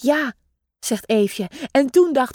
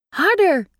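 calling out; 6 semitones higher than usual. A narrator's reading. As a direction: call out to push him on. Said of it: "roept mama meeuw".

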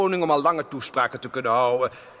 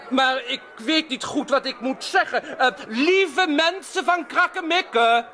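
nervous speech; 11 semitones higher, slower than usual. Acted. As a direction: call out to the crowd nervously. King addresses the people.

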